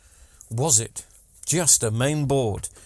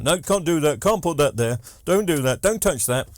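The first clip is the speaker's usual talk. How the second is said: imitating staff who refuse everything, higher voice; moaning jobsworth; 3 semitones higher, 4 dB louder than usual. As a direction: rattle off objections like a grumpy jobsworth. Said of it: "looking for something to moan about".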